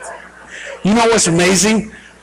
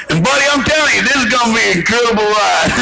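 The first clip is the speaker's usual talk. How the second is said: Elmer Fudd-like pilot; 3 semitones higher, faster than usual. Acted. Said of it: "who let Elmer Fudd fly the airplane".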